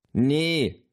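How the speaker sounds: high-pitched called-back reply. A narrator's reading. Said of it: "rief Luisa". (hoch zurückgerufen)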